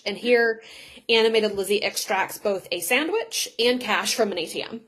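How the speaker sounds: ordinary delivery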